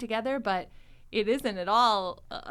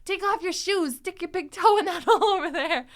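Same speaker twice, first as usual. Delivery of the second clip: frantic Joey; 10 semitones higher, 8 dB louder than usual. Retold or imitated. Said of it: "Joey's like".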